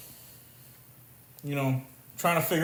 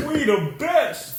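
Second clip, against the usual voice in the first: slow, high [name] drawl; [name] impression; 9 semitones higher, slower than usual. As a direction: shout his tag line slowly. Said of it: "I'll just be D J Khaled".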